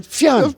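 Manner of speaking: high mock punch sound; high hammed-up fiam; Nigerian Batman sound effect. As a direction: fling it out high like a comic punch. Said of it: "If, uh, Batman were Nigerian".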